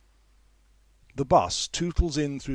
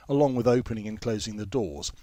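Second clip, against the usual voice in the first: ordinary delivery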